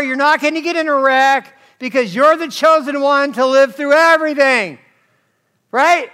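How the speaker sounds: loud, high, mocking sermon voice; preaching at full force. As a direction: preach it loud, mocking their sense of being invincible. Mocking teens who think they're invincible.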